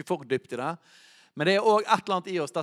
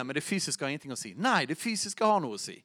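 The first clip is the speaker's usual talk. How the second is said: normal talking voice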